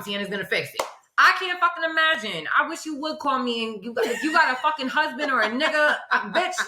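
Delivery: ordinary delivery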